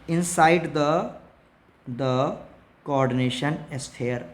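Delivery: very slow, spaced-out dictation. Shown as a demonstration. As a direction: stretch every word so students can write. Teacher dictating rule slowly.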